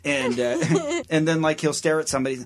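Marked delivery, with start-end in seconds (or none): none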